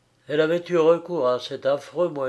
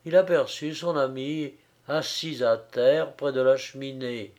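ordinary delivery

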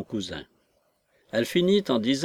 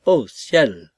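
stunned exclamation; 7 dB louder than usual. A narrator's reading. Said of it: "s'écria l'homme".

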